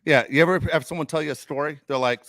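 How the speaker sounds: normal talking voice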